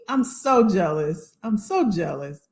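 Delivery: ordinary talk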